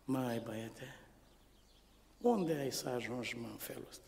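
put-on soft, low rebuke of a bartender to a boy; tavern keeper scolding. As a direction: quietly shame the little boy. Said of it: "Crâșmărița, la mine".

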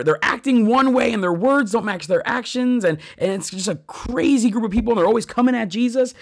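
usual speaking voice

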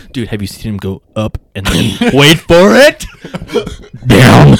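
loud, building to a shouted reveal; excited uncle. Pretend. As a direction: tease it, then shout the punchline. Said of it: "I love the idea that he's like".

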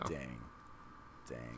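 very low, barely audible awe; stunned hush. Real, not acted. Co-host left stunned by the idea, hushed awe. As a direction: let it fall away, amazed.

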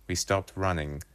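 ordinary delivery, nothing marked

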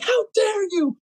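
high, shrill-edged imitation of an outraged boss; hammed-up offended owner; mock angry boss. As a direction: mimic her indignant scolding, high and slowed. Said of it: "she was like".

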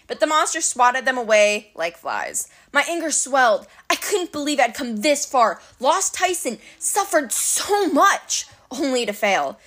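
ordinary delivery, nothing marked

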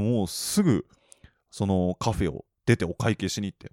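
ordinary talk

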